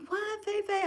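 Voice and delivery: mock startled mom. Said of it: "She was like".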